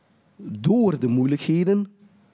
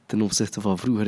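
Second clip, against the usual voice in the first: usual speaking voice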